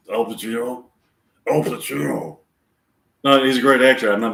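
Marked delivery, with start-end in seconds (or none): 0.1-0.9 a light attempt at Pacino's voice, timbre changed; first try at the Pacino voice, not yet settled; Al Pacino impression. Shown as a demonstration. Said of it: "try to talk like Al Pacino". 1.5-2.4 Al Pacino impression. Shown as a demonstration. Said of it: "try to talk like Al Pacino".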